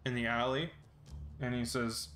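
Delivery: ordinary delivery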